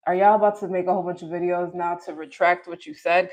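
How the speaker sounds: ordinary delivery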